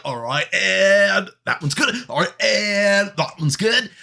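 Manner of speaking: slow, drawn-out "and" before each placement; careful, failed hurry. Acted. Player acts out stacking food carefully, slow and drawn out. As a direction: stretch it out while balancing each item. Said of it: "trying to make it like it's fast, but it's not going very well".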